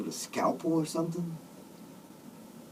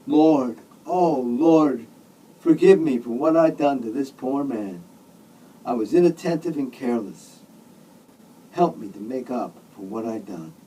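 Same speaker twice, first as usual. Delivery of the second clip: fervent, louder pleading prayer; praying aloud; 5 dB louder than usual. A narrator's reading. Weeping truck driver prays at the bedside, pleading. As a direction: call out to the Lord and plead. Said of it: "but then he started praying".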